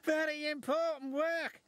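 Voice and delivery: high, eager engine voice; excited exclamation. A narrator's reading. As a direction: exclaim it high, thrilled by the job. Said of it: "exclaimed James".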